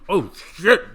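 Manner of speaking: loud imitation of startled alarm; hammed-up shocked yelp; mock shocked outcry. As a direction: act jolted awake by a punch. Acts passenger woken by punches.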